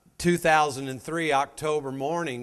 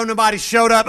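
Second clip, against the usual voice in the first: normal talking voice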